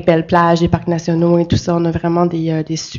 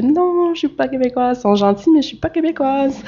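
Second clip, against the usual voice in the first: exaggerated comic "no" voice, higher; mock protest; 7 semitones higher than usual. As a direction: act out a good-natured protest, pitched up. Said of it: "Puis je fais".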